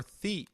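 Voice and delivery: "thi" with a soft th; the Castilian "thi"; European thi sound. Shown as a demonstration. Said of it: "in European Spanish".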